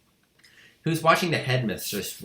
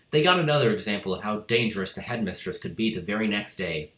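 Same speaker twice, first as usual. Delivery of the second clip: ordinary delivery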